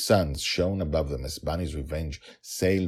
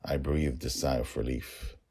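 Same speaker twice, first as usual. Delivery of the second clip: usual speaking voice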